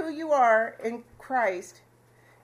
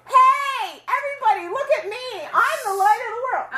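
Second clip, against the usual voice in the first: high, loud hammed-up shouting; mock street-corner scream; 11 semitones higher, 7 dB louder than usual. Preacher mocking a street-corner shouter, loud mock scream. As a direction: yell it like a show-off on the corner. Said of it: "stand on the corner and scream".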